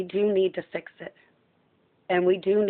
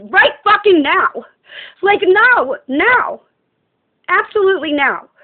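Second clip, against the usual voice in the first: shouting it out, much higher and louder; angry shout; 11 semitones higher, 9 dB louder than usual. Real, not acted. Ranting to the camera about fixing the world, a furious shout. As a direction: hammer home the urgency at the top of her voice. Ranting, demanding action immediately.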